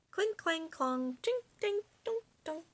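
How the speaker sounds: high clinking sounds; high playful clanking; armor clanking. Acted.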